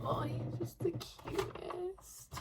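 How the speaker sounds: high, slow, breathy-free coo of affection; gushing. Real, not acted. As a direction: melt over how sweet he is. Gushing over boyfriend's flowers.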